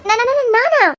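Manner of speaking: stunned stammer at being named witness; shocked stammer. Acted. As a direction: stumble over the word in shock.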